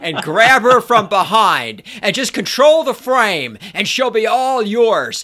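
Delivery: loud, hard-sell dating guru; hammed-up influencer sales spiel; manosphere YouTuber. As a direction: pitch it forcefully like an online hustler. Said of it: "the manosphere equivalent of your ranger".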